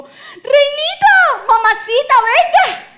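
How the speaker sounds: very high, loud, stretched-out holler; comic doting king calling his queen over; hammed-up delighted calling. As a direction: belt it out high, drawing out the words. King delightedly calls queen, acted.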